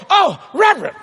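high mock-excited recognition of a VIP; fawning restaurant greeting. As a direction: imitate staff exclaiming at a famous guest. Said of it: "They go".

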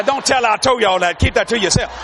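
normal talking voice

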